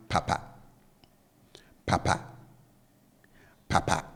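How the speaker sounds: "papa" with both syllables kept low. Shown as a demonstration. Teacher showing learners the second Twi word, low-low tone on "papa". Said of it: "I bring the tone on both syllables down".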